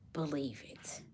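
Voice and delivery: breathy hushed whisper for effect; dramatic whisper. Acted. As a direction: drop to a secret whisper. Dramatic hushed reveal to audience.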